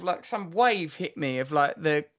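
ordinary talk

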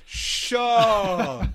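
loud and high, thrilled to see her; delighted exclamation. Retold or imitated. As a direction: call her name in delight. Greeting Sian in delight.

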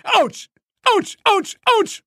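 very high squeaking yelps; a kicked ball crying out; squeaky ouch. A narrator's reading. The kicked ball yelps at each hit, squeaky. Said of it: "machte der Myxinball".

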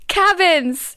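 rally the crowd; hyped crowd warm up. Pretend. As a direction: hype man call. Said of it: "When I say cabins".

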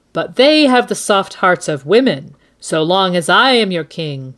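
put-on loud, imperious young king passing sentence; public decree. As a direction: proclaim it to a crowd, building to the order. Joffrey's public sentence, acted out.

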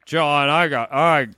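low, weary cartoon cat; too lazy to stand, voice sinking; Garfield. Garfield grumbling to John, fat lazy voice. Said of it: "That's how Garfield sounds".

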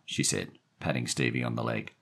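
plain reading voice

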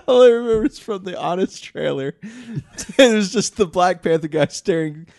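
giggling through the words; laughing. Real, not acted. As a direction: laugh while you tell it. Recalling a funny trailer, laughing.

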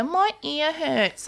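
put-on high, slow, whiny little-kid voice; sick little boy. Young son waking up with an ear infection, whining in a high, slow voice. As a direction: drag it out like a poorly three-year-old. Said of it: "then he'd wake up and go".

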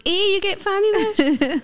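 high, laughing schoolkid jeer; mocking playground taunt. Kid taunting a classmate, high laughing jeer. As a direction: tease like a cheeky primary kid.

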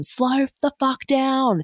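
high-pitched yelled plea to slow down; retold yell. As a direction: yell it at him, scared on the back of the scooter. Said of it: "literally yelling at him".

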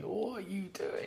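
hushed inner bewilderment; whispered thought. Retold or imitated. Wondering why strangers want selfies, whispering. Thinking during selfie requests.

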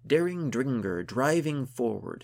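loud, high, rhythmic verse; poem recitation. A narrator's reading. Gunhild recites her heroic poem, declaiming. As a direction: declaim it in a strong beat. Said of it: "As she recited".

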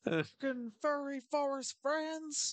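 high, slow mock declaiming; mimicked proclamation. Co-host adding a swear to the coach's line, mock-proclaiming. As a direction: echo it in a silly stretched-out high voice.

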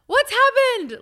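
loud, high-pitched worried shout; re-enacted alarmed holler; retold yell. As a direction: yell it out high, as if to another room. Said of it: "So I yell out".

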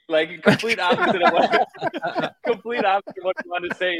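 real laughter breaking through the words; laughing. Real, not acted. Telling the hosts the funny story, laughing through it. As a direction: laugh helplessly as you explain.

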